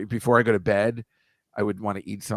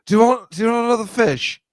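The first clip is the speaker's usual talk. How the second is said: high, loud, put-on voice for the drunk; drunk Arthur offering; 12 semitones higher, 7 dB louder than usual. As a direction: ham up the boozy gentleman offering more food. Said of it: "And then he goes to her".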